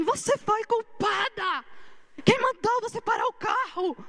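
retold blaming outburst at the woman driving; imitating an angry driver. Furious driver yelling at her after the crash, imitated angry accusation. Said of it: "veio com tudo pra cima de mim".

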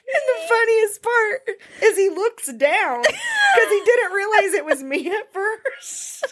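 high, crying with laughter; laughing while talking. Real, not acted. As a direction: squeeze the words out through tears of laughter. Laughing through the punchline.

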